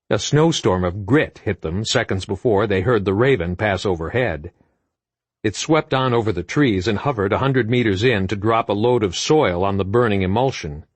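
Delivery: ordinary delivery, nothing marked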